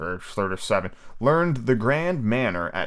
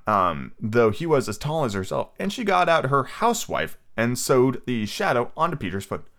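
ordinary delivery

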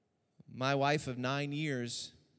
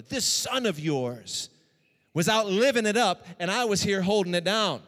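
louder, higher, resentful outburst; angry brother; 6 semitones higher, 8 dB louder than usual. Acted. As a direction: spit out the complaint. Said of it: "He's angry".

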